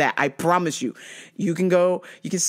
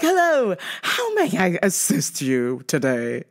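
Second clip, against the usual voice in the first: overdone customer-service politeness; slower than usual. Retold or imitated.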